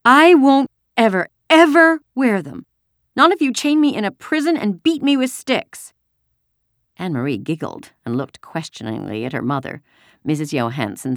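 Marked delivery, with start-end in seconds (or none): 0.0-5.9 put-on high, loud, indignant little girl; child's outraged protest, pitched way up; sputtering tantrum. A narrator's reading. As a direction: refuse like a stubborn small child. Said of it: "Kirsty sputtered".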